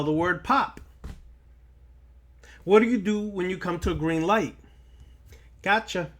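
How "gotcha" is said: ordinary delivery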